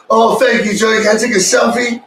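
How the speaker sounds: high, laughing starstruck gush; laughing mock fan. Friends joke about meeting Joey Diaz, laughing fan gush.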